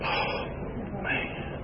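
faint whispered groan of dismay. Retold or imitated. Hearing about weekly drug tests, whispered dismay. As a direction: whisper it, deflated.

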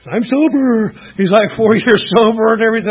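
loud, high and beaming; proud excitement. Retold or imitated. Old friend proudly tells Tim he is sober, loud and high. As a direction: boast about the years clean.

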